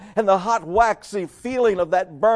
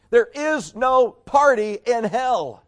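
ordinary talk

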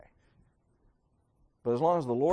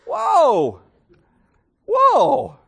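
loud, high mock whoa; a hammed-up startled cry; 9 semitones higher, 8 dB louder than usual. Mocking hasty churchgoers at dismissal.

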